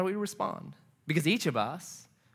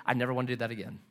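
lower, softer quoted remark; understated aside; 5 semitones lower, 7 dB quieter, much faster than usual. Retold or imitated. Roommate telling his friend afterward, understated and quiet. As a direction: say it low and softly, as an understated confession. Roommate recalls detached shock.

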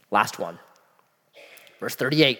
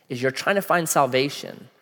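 usual speaking voice

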